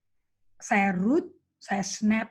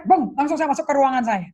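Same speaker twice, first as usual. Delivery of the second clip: usual speaking voice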